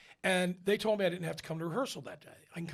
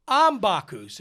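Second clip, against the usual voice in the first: much louder, higher protest; loud insistence; 4 semitones higher, 12 dB louder, slower than usual. Retold or imitated. Tenor asserts his role to the doubting Italian, loud and insistent. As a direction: insist on who you are, belting it out.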